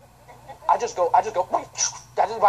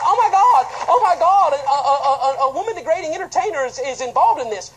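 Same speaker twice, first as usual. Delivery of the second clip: louder, higher, gushing mock fan with a laugh; mock excited gushing; 6 semitones higher, 8 dB louder than usual. Preacher mocking Christians gushing over the rapper, mock excitement. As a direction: ham up the starstruck Christians.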